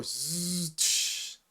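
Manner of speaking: buzzing slide then hissed slam; zipping zzz into sharp tsh; imitated truck door. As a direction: mouth noise of a rolling door and thud.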